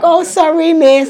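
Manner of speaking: high, loud mock apology; exaggerated pupils' sorry; imitated apologetic pupils. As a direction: mimic sheepish schoolchildren, high-pitched. Acts pupils apologising to teacher.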